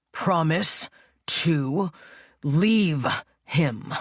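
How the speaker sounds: very slow, deliberate demand; slowly. A narrator's reading. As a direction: draw each word out slowly. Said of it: "He repeats the words slowly this time".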